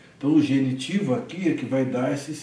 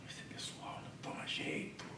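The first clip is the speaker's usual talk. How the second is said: whispered aside; 23 dB quieter than usual. Real, not acted. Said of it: "whispered aside".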